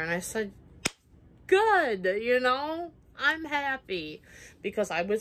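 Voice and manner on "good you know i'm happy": sassy retort. Retold or imitated. Answering the ex who no longer likes her, sarcastically cheerful. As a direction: gloat at him, brightly sarcastic.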